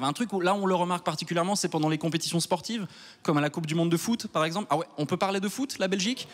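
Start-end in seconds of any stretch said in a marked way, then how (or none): none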